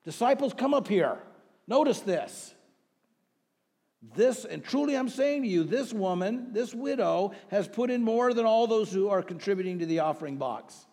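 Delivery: high, loud, urgent call to gather round; Jesus calling disciples. Acted. Jesus summons his disciples to notice the widow, loud proclaiming. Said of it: "Jesus called his disciples to him and said".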